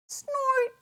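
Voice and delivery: high squeaky piggy snort; a piglet's sharp nasal snort. A narrator's reading. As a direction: snort like a little pig. Pig snorts.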